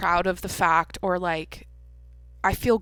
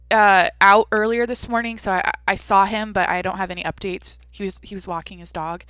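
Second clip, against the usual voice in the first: normal talking voice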